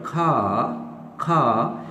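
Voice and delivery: खा with a puff of air on the k, no final r; British खा, open vowel, silent r; aspirated British k. Shown as a demonstration. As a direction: say खा slowly with a strong aspirated k. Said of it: "मैं खे कह रहा हूँ".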